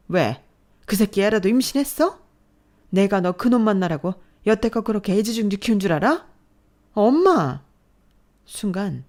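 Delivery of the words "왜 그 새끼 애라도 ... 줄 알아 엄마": loud, high, biting yell; furious scolding. Acted. Mother attacking daughter, furious yell. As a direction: lash out at her.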